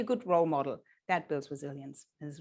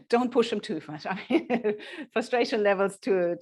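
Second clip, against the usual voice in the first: normal talking voice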